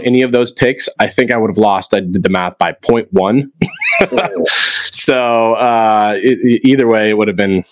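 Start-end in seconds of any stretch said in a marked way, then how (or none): 3.6-4.9 sudden high-pitched laughing squeal; real bursting laugh. Real, not acted. Laugh after math.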